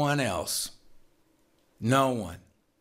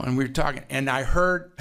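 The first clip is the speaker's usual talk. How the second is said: usual speaking voice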